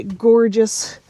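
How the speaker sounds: normal talking voice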